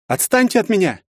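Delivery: put-on high, frightened girl shouting back; a scared girl pushing them away; frightened cry. Scared girl yells at thugs, high and frightened. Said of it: "в голосе отчётливо послышался испуг".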